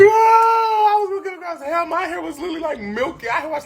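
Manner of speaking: high, loud excited shout. Real, not acted. Friend to friends, hyped about her hair. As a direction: hype it up. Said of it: "retelling with excitement".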